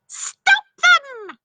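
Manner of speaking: very high, loud witch shriek; screeching wicked witch; witch's scream. Acted. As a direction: shriek at the fleeing children. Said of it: "screamed the witch".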